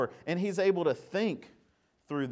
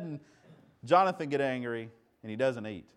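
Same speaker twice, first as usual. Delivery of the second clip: ordinary talk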